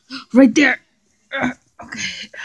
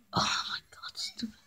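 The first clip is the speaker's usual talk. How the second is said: muttered insult; 21 dB quieter than usual. Acted.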